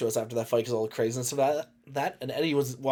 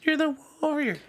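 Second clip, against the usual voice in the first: mock cheer; 13 semitones higher than usual. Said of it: "girlfriend cheering".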